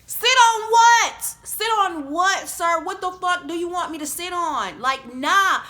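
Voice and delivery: loud, high, drawn-out outrage; incredulous high-pitched yell; outraged disbelief. Retold or imitated. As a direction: shout it in indignant disbelief. Outraged reaction to a proposition.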